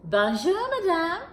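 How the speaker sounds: high cheerful greeting. Acted. Lion greets Madame Poisson.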